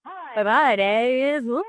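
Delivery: Hi, my name is, stretched out slow with odd stress; odd emphasis. Shown as a demonstration. Drama student demonstrating an odd stressed line, slow. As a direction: drama school drill, saying the words in weird ways, slow. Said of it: "we emphasis on different things".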